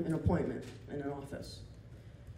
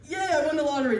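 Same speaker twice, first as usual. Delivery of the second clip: mock triumph; 7 semitones higher, 7 dB louder, faster than usual. Finding the right cubicle.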